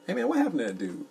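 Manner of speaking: ordinary delivery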